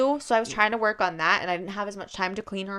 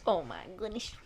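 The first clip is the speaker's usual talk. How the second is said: granny voice; 10 dB quieter than usual. Real, not acted. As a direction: slip into a granny exclamation. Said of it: "Why did your granny voice come out?".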